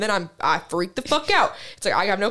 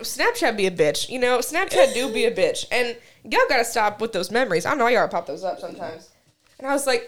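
ordinary talk

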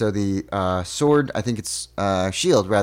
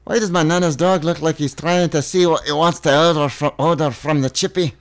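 higher-pitched Scottish question; Scottish accent; 4 semitones higher than usual. Acted. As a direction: puzzle over it like a Scot. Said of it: "You're so from Scotland".

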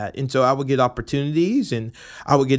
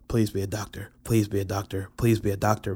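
quiet pleading; 4 semitones lower, 6 dB quieter than usual. Retold or imitated. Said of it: "I would always go".

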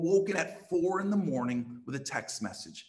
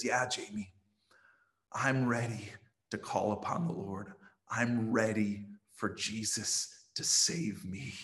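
tearful; 6 semitones lower, 5 dB quieter than usual. Retold or imitated. Philo telling the pastor he is ready, soft and tearful. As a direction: let the tears choke it quietly. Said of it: "tears coming down his face".

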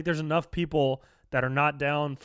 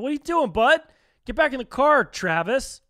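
mock calling out; 8 semitones higher, 5 dB louder than usual. Calling to a gas station dude.

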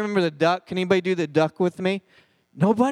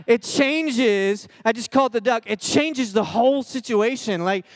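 ordinary talk, nothing marked

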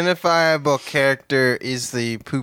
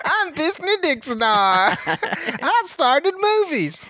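high, slow grand self-introduction; pompous mogul voice; 11 semitones higher, slower than usual. Pretend. Playing a made-up mogul.

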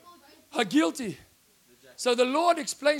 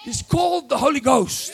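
loud, falling proclamation; preaching shout; 4 semitones higher, 7 dB louder than usual. Real, not acted. Preacher proclaiming the Holy Ghost to the congregation, loud. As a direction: proclaim it to the back row.

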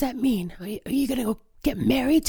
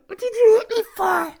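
high tearful wail; pained crying; 8 semitones higher, 5 dB louder than usual. Acted. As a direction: cry out, hurt and betrayed. Just punched in the face.

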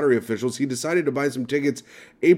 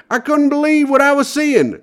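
Michigan lottery winner impression; 13 semitones higher, 4 dB louder than usual. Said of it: "my impression of what I think he sounds like".

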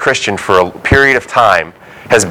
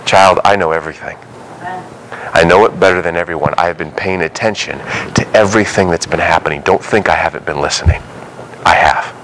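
put-on lower, gentle voice of God to his child; a hint of a lower, fatherly God; 7 semitones lower than usual. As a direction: speak low and tender, as a father reassuring. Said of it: "And God will go".

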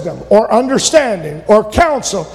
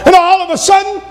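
excited preaching; 9 semitones higher than usual. Acted. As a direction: drive the miracle home. Preaching at full force.

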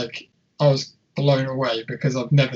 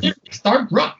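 put-on New Zealand accent; 6 semitones higher than usual. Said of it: "a New Zealand accent".

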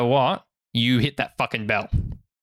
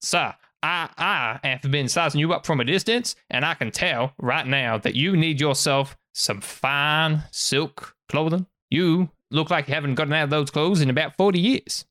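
put-on southern accent; 3 semitones higher than usual.

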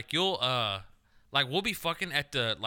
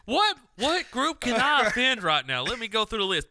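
mock outrage; 10 semitones higher, 6 dB louder than usual. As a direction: boom it out like a comic rant. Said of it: "I'm gonna let [name] do his voice".

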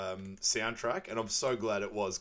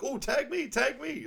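much higher, eager cartoon-donkey plea; Donkey's voice. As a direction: squeak it out like an excited Donkey begging to join. Donkey begging to be tagged in.

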